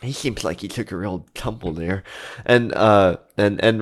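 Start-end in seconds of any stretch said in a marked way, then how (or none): 0.0-2.0 soft, low worried murmur; hushed aside. Acted. Friends doubting the fallen man's health, hushed. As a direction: drop well under your voice, confiding. Friends confer about the injured man.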